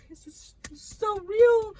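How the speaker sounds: tearful. Real, not acted. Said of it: "speaker close to tears".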